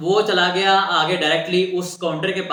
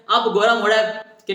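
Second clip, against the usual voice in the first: ordinary talk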